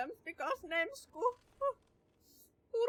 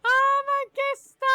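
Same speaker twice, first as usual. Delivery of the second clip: high, loud cry of overwhelm; panicked wail; 9 semitones higher, 9 dB louder than usual. Real, not acted. Player losing it at the horror game, loud and high. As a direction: let it burst out like you can't bear it.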